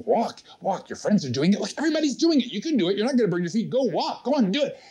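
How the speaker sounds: high, urgent nagging; pushy inner voice. Acted. Inner voice goading a firewalker onto the coals, pushy. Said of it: "If there's a voice in there going".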